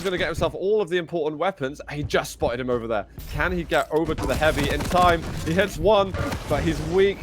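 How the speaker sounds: rising excited play-by-play calling; esports caster. Acted. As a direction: build the hype as the fight unfolds. Live play-by-play.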